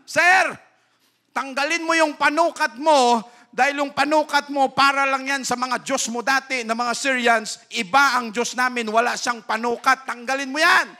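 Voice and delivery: put-on loud, high pleading voice of a servant girl; urgent preaching shout. Servant girl urging Naaman, loud and high. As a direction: cry it out like a maid begging her master.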